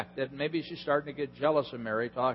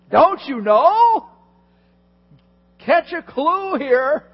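higher, louder and slow, a fed-up sister; exasperated Martha; 12 semitones higher, 10 dB louder, slower than usual. Retold or imitated. Preacher voicing Martha as she begs Jesus, in a high, exasperated voice. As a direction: drag out each word, pleading for sympathy.

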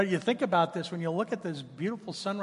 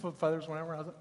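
ordinary talk, nothing marked

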